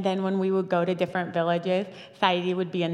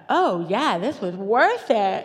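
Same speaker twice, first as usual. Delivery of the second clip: loud, drawn-out, swooping delight; exaggerated gleeful vindication; gleeful realisation; 2 semitones higher, 6 dB louder, slower than usual. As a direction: stretch it out triumphantly, ending in a laugh. Elated realisation, retold to audience.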